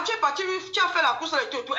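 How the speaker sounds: usual speaking voice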